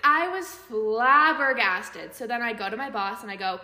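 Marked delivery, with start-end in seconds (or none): 0.0-2.1 loud, slow, drawn-out "flabbergasted"; emphatic astonishment. Retold or imitated. Friend recounting a creepy text, loudly stretching "flabbergasted". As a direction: stretch "flabbergasted" out at full volume. Storyteller stressing shock at the text.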